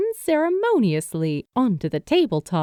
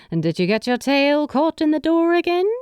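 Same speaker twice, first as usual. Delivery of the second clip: knowing, teasing; 6 semitones higher, faster than usual. A narrator's reading. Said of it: "she pressed".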